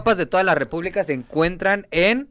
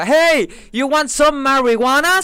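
high, slow stoner drawl; mock American asking about weed; gringo accent; 6 semitones higher, 4 dB louder, much slower than usual. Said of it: "y el otro".

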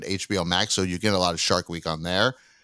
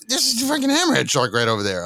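excited; 9 semitones higher than usual. Retold or imitated. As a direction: point it out like you can't believe your eyes. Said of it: "I'm just like".

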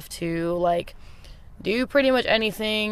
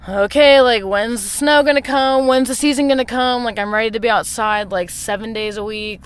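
bright, eager and impatient; itching to get outside; eager inner voice; 5 semitones higher, 7 dB louder than usual. Retold or imitated. Retelling own impatient thoughts.

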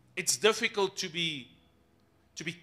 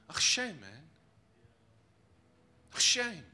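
mock pity. Preacher imitating people who pity sufferers, mock sympathy. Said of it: "People pity".

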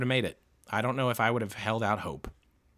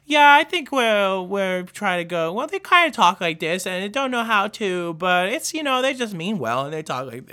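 put-on high, loud, halting awkward-teen voice; socially awkward kid voice; 8 semitones higher, 5 dB louder than usual. Vlogger mocking a socially awkward classmate's voice, high and halting. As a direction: mimic the odd kid from middle school, higher. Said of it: "they have that weird affect in their voice".

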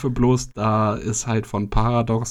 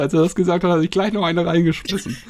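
laughing; 7 semitones higher, 3 dB louder than usual. Real, not acted. Laughing while telling.

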